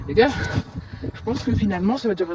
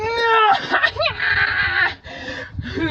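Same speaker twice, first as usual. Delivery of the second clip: high burst of laughter; 13 semitones higher than usual. Real, not acted. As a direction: laugh it off after losing. Caught, game over.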